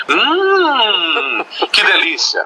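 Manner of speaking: lingering, pleased "hum" of delight; savoring a tasty dish. Retold or imitated. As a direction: react like tasting your favorite food. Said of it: "Como é que vocês falam?". (saboreando com prazer)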